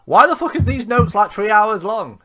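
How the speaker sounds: loud, high exasperated yell; exasperated outburst. Retold or imitated. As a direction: blurt it out in disbelief. Retold own frustrated outburst.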